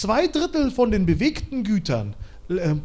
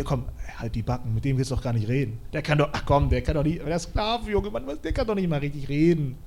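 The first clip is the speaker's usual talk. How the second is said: lower, quieter, sneering dismissal; dismissive mockery; 7 semitones lower, 4 dB quieter, faster than usual. Family sneering at stuttering Moses, low contempt. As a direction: brush him off with contempt.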